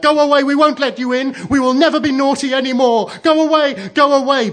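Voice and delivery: very high, loud panicked shrieks; desperate childish pleading at full volume; calling out. A narrator's reading. Children begging new mother to leave, shrieking. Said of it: "they called out".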